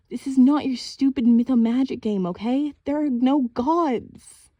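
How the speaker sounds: high, loud, slowing insistence; angry denial. A narrator's reading. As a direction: refuse to believe, scolding. Sister scolding in denial.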